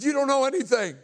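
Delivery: high, loud angry retort; defiant shout. Retold or imitated. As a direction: fire it back at full voice. Defiant retort in retold confrontation.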